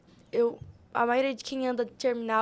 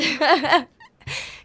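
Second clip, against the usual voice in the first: laugh; 3 semitones higher, 8 dB louder than usual. Real, not acted. Laughing while telling friends about the seller. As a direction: laugh out loud at the memory.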